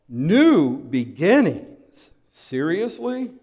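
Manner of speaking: slow, drawn-out sarcastic question; sarcastic disbelief. Acted. Preacher mocking new beginnings before the army, sarcastic. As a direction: let the irony hang, then scoff.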